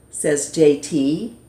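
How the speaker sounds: usual speaking voice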